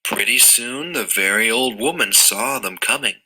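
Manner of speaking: ordinary delivery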